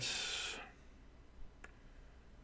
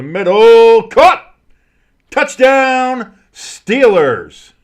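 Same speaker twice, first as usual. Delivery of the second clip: high, loud yell with stretched words; excited touchdown shout; 10 semitones higher, 11 dB louder, much slower than usual. Real, not acted. Announcer calling a go-ahead touchdown, excited shout. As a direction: build from the throw to a full shout.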